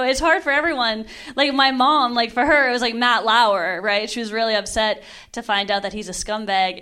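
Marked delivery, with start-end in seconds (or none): none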